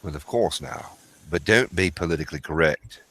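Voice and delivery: low, soft English-accented impression; Chris Collier. Shown as a demonstration. Man answering his friend in character, low soft English accent. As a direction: drop into a quiet, measured English gentleman. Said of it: "That right there would be Chris, Chris Collier".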